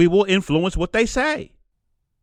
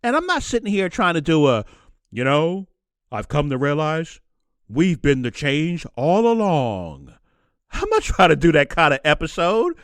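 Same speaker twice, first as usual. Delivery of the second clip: ordinary talk, nothing marked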